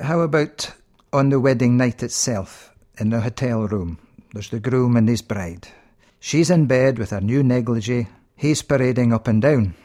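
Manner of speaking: ordinary talk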